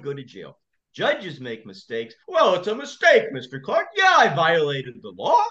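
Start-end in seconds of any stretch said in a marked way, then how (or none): 2.3-5.4 high mock judge rising to a yell; shouting judge. Judge yelling at the lawyer for going over his head, high shout. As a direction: huff and then explode as the offended judge. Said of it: "he was shouting at me".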